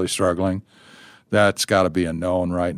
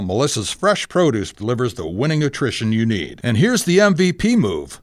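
ad read; 4 semitones higher than usual. Acted. Host reads produce sponsor ad.

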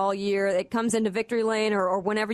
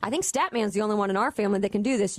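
ordinary talk

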